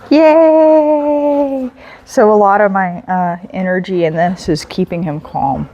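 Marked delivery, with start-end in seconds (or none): 0.1-1.7 high, loud cheer; happy release. Real, not acted. Trainer releasing the dog with a high happy cheer. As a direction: cheer him free. Release after calm exercise.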